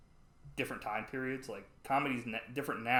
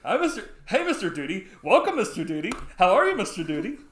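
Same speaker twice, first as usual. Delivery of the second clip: loud, high-pitched mock-gushing hellos; fawning greeting; 9 semitones higher, 13 dB louder than usual. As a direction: imitate someone sucking up to a VIP. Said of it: "he was, like, kissing his ass".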